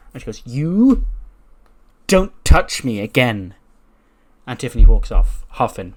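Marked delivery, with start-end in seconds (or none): 0.4-3.5 furious warning. Retold or imitated. Tiffany after being slapped.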